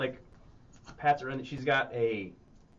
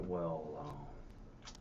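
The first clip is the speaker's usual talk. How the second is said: low drawling NPC voice; 10 semitones lower, 17 dB quieter, slower than usual. Acted. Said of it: "Jeremy's like".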